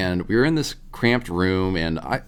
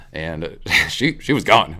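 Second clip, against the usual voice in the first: ordinary delivery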